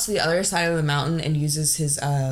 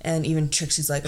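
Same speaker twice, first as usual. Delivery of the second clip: ordinary delivery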